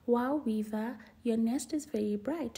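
usual speaking voice

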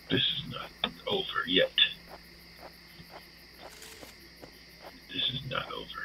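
soft unvoiced vow; grim whisper. Player vowing revenge on the pumpkin, grim whisper.

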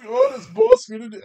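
very high, quick squeaky voice; hapless henchman. Acted. Bandit wonders as boss arms himself, squeaky henchman. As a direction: squeak it out fast, oblivious.

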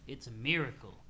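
low, soft reverent stoner voice; laid-back troll, awed. Acted. Troll answers his friend's rant with mellow, low awe. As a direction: sigh it out in mild, mellow wonder.

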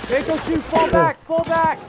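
urgent shout. Real, not acted. Urgent call to squad under fire.

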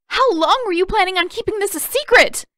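high, loud enraged yell. Acted. Katara yells at Aang about the secret map, enraged. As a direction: hurl the question at him. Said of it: "she yelled".

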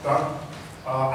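ordinary delivery

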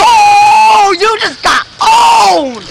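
slow teasing yell; gloating shout. Real, not acted. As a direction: rub it in loudly. Teasing the other kid.

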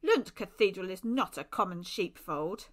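loud, stern rebuke; stern priest laying down the rule. A narrator's reading. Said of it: "he said sternly".

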